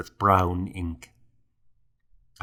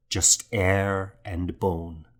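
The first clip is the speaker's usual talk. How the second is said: ordinary delivery, nothing marked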